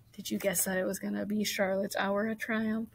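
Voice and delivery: ordinary delivery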